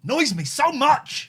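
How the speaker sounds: loud, slow, real exasperation; annoyed outburst. Real, not acted. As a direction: vent real irritation. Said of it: "I can tell".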